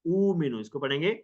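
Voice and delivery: normal talking voice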